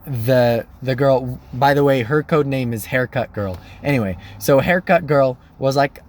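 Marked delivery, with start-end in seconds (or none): none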